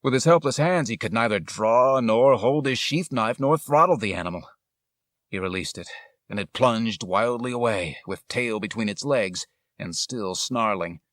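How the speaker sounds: usual speaking voice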